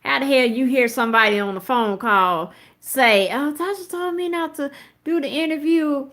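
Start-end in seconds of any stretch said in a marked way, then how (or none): 3.3-6.1 lightly voiced higher mock caller; a touch of a caller's voice; quoting caller. Mocking the phone caller to viewers, lightly voiced higher.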